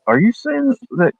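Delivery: high, hushed put-on voice; high whispered question. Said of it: "and I'm like".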